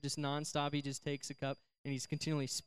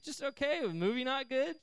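high, rising mock surprise; probing question; 10 semitones higher than usual. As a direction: press for more, voice lifting high at the end. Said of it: "I was like".

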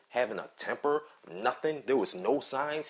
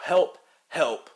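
louder imitation of a screamed plea; retold cry for help; 3 semitones higher, 6 dB louder than usual. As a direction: re-enact the kid's screams, only half shouted. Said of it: "This kid is screaming out".

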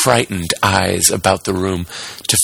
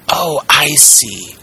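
high, slow, drawn-out realisation; bravely amused carpenter; 6 semitones higher, slower than usual. A narrator's reading. Scared carpenter dismissing the voice, high and slow. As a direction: slowly put on a brave front. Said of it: "trying bravely to laugh".

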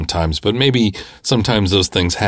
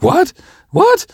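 very high, loud double take; mock shriek of disbelief; shocked; 5 dB louder than usual. Wife learning of the cut hand, shocked.

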